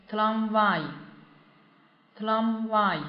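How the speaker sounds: tramvай very slow, stress on the last а. Shown as a demonstration. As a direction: model tramvай with the weight on вай. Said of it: "重音在这个啊上面".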